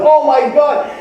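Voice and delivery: high-pitched gushing "everybody" reaction; exaggerated wowed exclamation; mock amazement. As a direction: act out an impressed crowd, pitched well up. Crowd reacts to honeymoon story.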